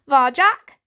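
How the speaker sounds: loud call across the garden; hailing Varjak. Acted. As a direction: call his name from the dark.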